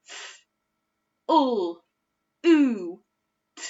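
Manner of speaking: F, ul, oo, t said as separate sounds; sounding out. Shown as a demonstration. Teacher sounding out flute the oo way. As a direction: segment F, ul, oo, t one at a time. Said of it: "say it the oo way".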